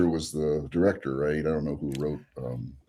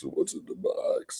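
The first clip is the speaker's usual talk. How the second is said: whispered movie quote; 5 dB quieter, slower than usual. Retold or imitated. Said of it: "three of us".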